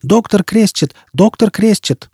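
high-pitched urgent call. A narrator's reading. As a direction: summon the doctor excitedly. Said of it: "вскричал Формайл".

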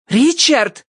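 sharp high-pitched call of a name; girl's high cry of protest. A narrator's reading. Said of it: "крикнула дверь".

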